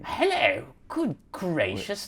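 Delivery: high, drawn-out Victorian British greeting; Dickensian British. Acted. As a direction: greet like an English gentleman from Dickens. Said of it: "like you're in a Charles Dickens book".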